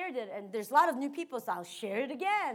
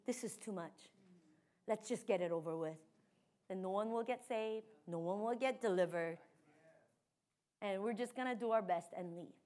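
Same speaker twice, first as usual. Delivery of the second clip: defeated thought; 4 semitones lower, 8 dB quieter than usual. Retold or imitated. Voicing her defeated thought.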